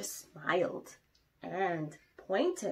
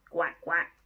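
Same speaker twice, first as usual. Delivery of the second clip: duck quack; 3 semitones lower, 6 dB louder than usual. Acted. Ducks quacking.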